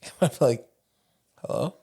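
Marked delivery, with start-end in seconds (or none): 1.4-1.8 high sweet therapist voice; sing-song therapist. Retold or imitated. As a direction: coo like a kindly school therapist. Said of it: "She would always say that".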